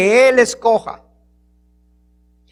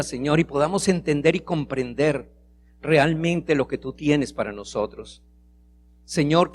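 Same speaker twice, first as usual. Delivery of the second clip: soft, lower prayer voice; 6 semitones lower, 5 dB quieter than usual. Acted. Pastor praying to God for the congregation, soft and reverent. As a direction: pray quietly, humbly to the Lord.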